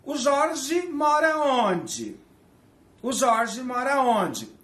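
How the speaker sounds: high, slow "mora" drawn out; slow demo question. Shown as a demonstration. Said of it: "em português eu pergunto assim".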